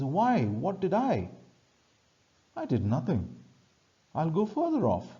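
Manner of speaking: high-pitched, protesting apology; apologetic. Acted. Trinculo, wrongly accused, protests to Stefano, mildly acted apologetic plea. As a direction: plead innocence with swooping high pitch. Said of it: "he is apologetic and he says".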